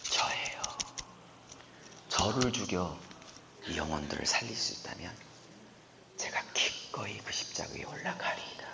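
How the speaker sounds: softly whispered vow of surrender; hushed surrender. Acted. Isaac softly offering himself to be sacrificed, whispered. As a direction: breathe it like a willing sacrifice.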